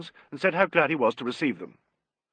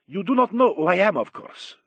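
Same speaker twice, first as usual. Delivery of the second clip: put-on marked Italian accent; 6 semitones higher, 3 dB louder than usual. A narrator's reading. Italian maestro introducing himself to a guest, marked Italian accent. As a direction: start big in an Italian accent, then toss off the end. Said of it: "spoke with a marked Italian accent".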